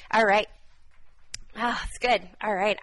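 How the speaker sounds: loud, fired-up exclamations. Real, not acted. Preacher carried away by the Spirit, loud and excited. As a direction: burst out with joy. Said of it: "I just get so fired up".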